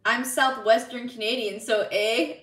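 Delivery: usual speaking voice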